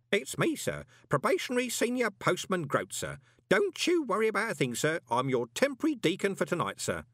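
put-on high-pitched, officious old postman; eager old postman. A narrator's reading. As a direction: deferential old deputy talking high and keen. Groat the old postman reassures Moist.

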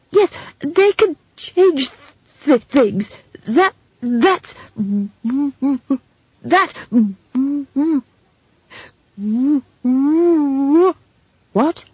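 high, halting, earnest stutter; stammering young man, urgent. Acted. Simon confiding his search to Esk, urgent stammer. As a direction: struggle eagerly to get each word out.

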